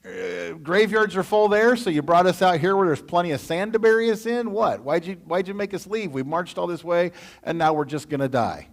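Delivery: loud, high mock whining; high, loud hammed-up grumbling; whining Israelites. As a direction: overdo the panicked complaining crowd. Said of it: "they went".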